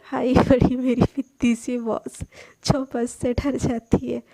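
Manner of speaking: mock her, giggling; mocking laugh. A narrator's reading. Sana mockingly laughs at her tiny boss.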